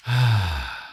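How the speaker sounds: long exhaled sigh; drawn-out releasing sigh; heavy sigh. Shown as a demonstration. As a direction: let the frustration out in one breath. Letting frustration out.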